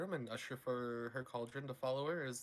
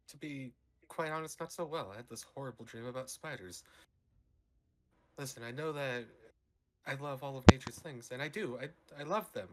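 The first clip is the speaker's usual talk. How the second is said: a touch of earnest confession, slightly raised pitch; lightly voiced polite character; 5 semitones higher, 8 dB louder than usual. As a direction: lightly put on a prim, honest character.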